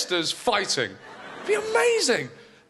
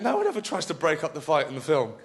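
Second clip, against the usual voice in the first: ordinary talk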